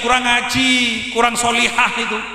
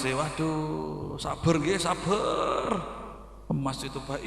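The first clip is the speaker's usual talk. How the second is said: much lower and softer, gently consoling; soothing friend; 7 semitones lower, 11 dB quieter, slower than usual. Retold or imitated. Friend consoles the widow, low and soft. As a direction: hush her tears like a sly comforter.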